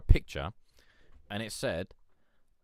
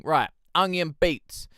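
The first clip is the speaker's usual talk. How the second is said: slow, loud announcer read; track announcement; 8 semitones higher, 11 dB louder, slower than usual. Acted. Introducing the next producer to the stream, slow and loud. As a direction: announce the next beat like a host.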